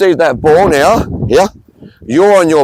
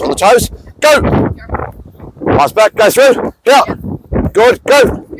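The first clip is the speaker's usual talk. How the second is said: shouted start; 7 semitones higher than usual. Real, not acted. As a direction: fire them off the mark. Coach starting the drill.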